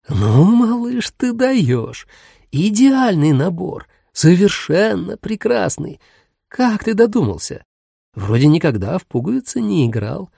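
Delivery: high, slow, cracked delight; thrilled old grandmother; delighted grandma. A narrator's reading. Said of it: "обрадованно сообщила бабушка".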